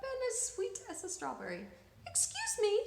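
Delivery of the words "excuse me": put-on high, cheery little-old-lady voice; bright old woman. Kind old woman greeting the family, high and bright. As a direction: chirp it sweetly. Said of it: "she said brightly".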